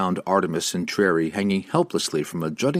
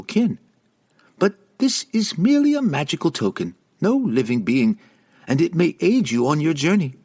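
Regis, pleading and high; 6 semitones higher than usual. A narrator's reading.

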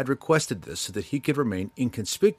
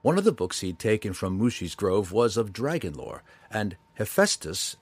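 ordinary delivery

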